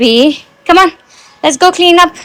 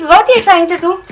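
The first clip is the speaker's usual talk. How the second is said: ordinary delivery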